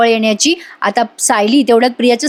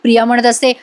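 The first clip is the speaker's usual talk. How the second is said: usual speaking voice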